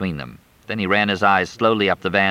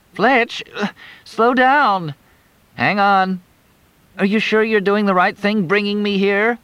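put-on high teasing young woman's voice; higher voice, wry and playful; Crystal; 13 semitones higher, 3 dB louder than usual.

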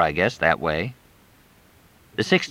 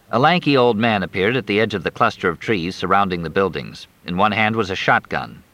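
ordinary delivery, nothing marked

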